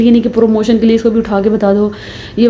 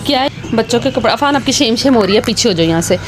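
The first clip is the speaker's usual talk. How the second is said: usual speaking voice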